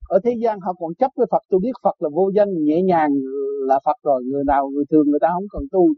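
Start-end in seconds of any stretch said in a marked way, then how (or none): none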